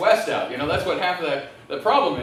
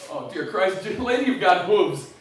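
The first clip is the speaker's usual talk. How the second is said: ordinary talk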